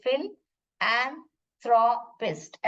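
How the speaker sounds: फिल, then एंथ्रोपिस्ट in slow separate chunks; split pronunciation. Shown as a demonstration. Teacher showing pupils how to read it, slowly split. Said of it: "ऐसे पढ़ोगे आप".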